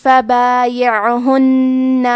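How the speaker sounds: فبایعہن slow, nasal نّ held; with ikhfa and ghunnah. Shown as a demonstration. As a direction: recite فبایعہن hiding the نون with nasal hum. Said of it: "تنوین کو چھپاتے ہوئے اور غنہ کے ساتھ".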